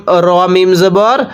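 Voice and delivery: usual speaking voice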